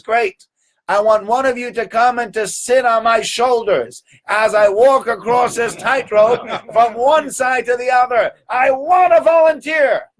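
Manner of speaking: high, loud showman calling for a volunteer; showman's call. Retold or imitated. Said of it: "He says".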